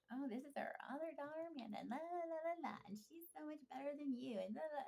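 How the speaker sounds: high, singsong parody of bragging; mocking gushing parents. Imitating fake parents going on about other daughter, high mocking prattle. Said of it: "are going on and on about".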